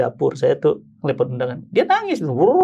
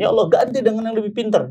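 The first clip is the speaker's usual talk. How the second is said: high-pitched mock-crying plea; retold tearful prayer; 6 semitones higher than usual. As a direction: act out begging God while crying. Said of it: "Udah tuh nangis di perjalanan". (menirukan doa memelas sambil menangis)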